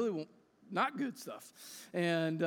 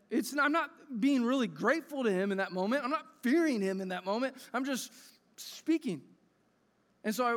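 raised, louder preaching voice building with each why; preaching at full force; 5 semitones higher, 4 dB louder than usual. Acted. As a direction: press the point home like a preacher.

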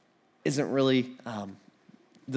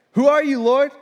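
startled question; 9 semitones higher, 6 dB louder than usual. Acted. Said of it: "Saul said".

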